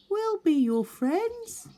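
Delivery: high, slow and kind promise; Little Beaver voice. Acted. Little Beaver promising the otter friendship, high gentle voice.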